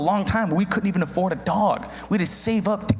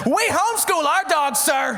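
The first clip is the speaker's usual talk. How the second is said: exaggerated Southern accent; 8 semitones higher than usual. Dog owner answering the neighbor about homeschooling dogs, thick Southern accent. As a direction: declare it proudly in a broad Southern accent. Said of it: "in the most southern accent".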